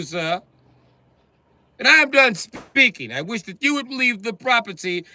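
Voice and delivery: high, loud, haughty proclamation; pompous little lord. Acted. Midget barricaded in his house, lording it over the cops. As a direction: declare it like a tiny king in his castle. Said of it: "like I'm Peter Dinklage".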